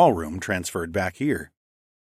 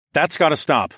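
higher, stern cold command; stone-cold; 6 semitones higher, 3 dB louder than usual. A narrator's reading. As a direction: shut it down coldly. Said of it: "in the same stone-cold voice".

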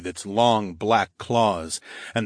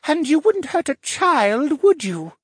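put-on young boy; 3 dB louder than usual. A narrator's reading. Spirit turned boy taunts the hero, childlike voice. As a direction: taunt in a high little boy's voice. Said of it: "transformed into the shape of a young boy".

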